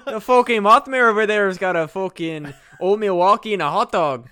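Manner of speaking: exaggerated Midwestern accent closer to northern Minnesota; terrible Chicago accent. Host reporting Mothman as a local, terrible Chicago accent. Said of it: "That's a terrible Chicago accent. ... sounds like northern Minnesota".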